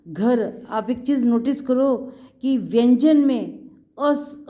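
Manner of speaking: normal talking voice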